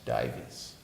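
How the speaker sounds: whispering "Dives" with no voice; whispered. Shown as a demonstration. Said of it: "D I V E S".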